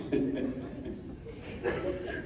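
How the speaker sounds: breathy laughter, slow and soft; giggling. Real, not acted. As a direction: laugh it out under your breath. Giggling at the pun.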